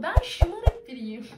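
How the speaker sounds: slow and loud, hitting Ben; defiant, self-owning boast; proud declaration. Real, not acted. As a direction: spell out Ben şımarık biriyim with spoiled pride. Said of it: "Evet, öyleyim".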